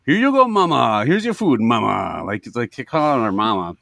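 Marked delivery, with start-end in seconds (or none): 0.1-2.2 serve it up in a raised showman's call; imitating over-familiar chef. Chef handing food to the customer's wife, hammed-up familiarity. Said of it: "turns to my wife and goes".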